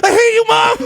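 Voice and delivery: high-pitched tantrum yell at mom; mock scream. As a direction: mock-scream it like a bratty kid. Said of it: "I can totally imagine myself screaming".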